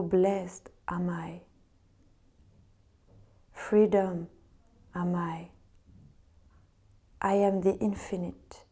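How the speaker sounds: meditative reading in French-accented English. Real, not acted. Yoga teacher reading Vivekananda to resting students, French-accented English. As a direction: read it calmly with a French accent, pausing between phrases. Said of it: "Je m'excuse d'avance pour mon accent".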